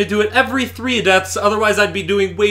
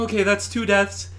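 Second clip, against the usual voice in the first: ordinary talk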